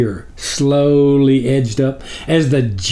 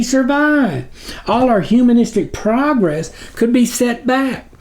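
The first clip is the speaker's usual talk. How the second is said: groveling minion; 8 semitones higher than usual. A narrator's reading. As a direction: plead upward to your dark master. Minion Snuffer explains the threat.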